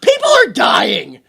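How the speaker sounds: loud, laughing rant at the captain; exasperated outburst. Retold or imitated. Mocking the stoic captain during the crash, loud laughing outrage. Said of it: "I'm like".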